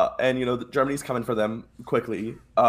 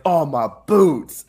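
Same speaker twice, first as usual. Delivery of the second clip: loud, drawn-out mock complaint; retold dismay; 2 semitones higher, 7 dB louder, much slower than usual. Retelling a soldier stepping in a body, mock dismay. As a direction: groan it out slowly, playing the soldier.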